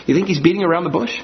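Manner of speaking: usual speaking voice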